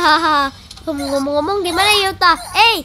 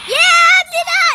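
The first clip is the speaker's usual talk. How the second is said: slow, high, loud cry of no; dismayed shout; 6 semitones higher, 3 dB louder, slower than usual. Real, not acted. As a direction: drag out a horrified no. Money about to be stolen. (teriakan kaget yang panjang)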